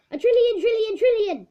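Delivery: high, loud triumphant cry. Acted. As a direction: crow it like you've won. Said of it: "in a triumphant tone".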